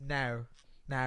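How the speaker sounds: soft, low Aussie-style "no"; teasing imitation of an Aussie "no"; mimicked Aussie no. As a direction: copy an Australian wife's "no" with a twisted vowel. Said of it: "his wife would say no".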